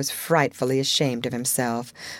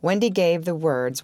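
ordinary delivery

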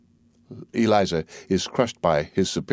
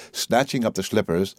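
plain reading voice

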